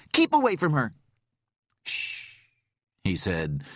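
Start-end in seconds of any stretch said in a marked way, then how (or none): 0.1-0.9 loud, high and angry; furious shout. Acted. 1.8-2.4 breathy voiceless shush. Acted. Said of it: "holding his gloved finger to his lips".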